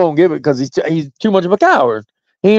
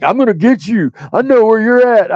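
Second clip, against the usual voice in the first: higher-pitched, babyish imitation of an empty threat; mocking baby voice; 6 semitones higher than usual. Host mocks rival's threat to find him, mocking baby voice. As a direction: mimic him as a whiny little baby. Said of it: "he sounded like such a baby".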